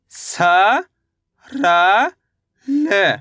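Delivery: each letter drawn out, with a long pause after it; stretched, separated syllables. Shown as a demonstration. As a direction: sound out the letters one at a time for learners. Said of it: "मेरे साथ साथ इनको repeat".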